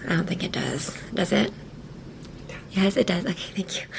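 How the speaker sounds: normal talking voice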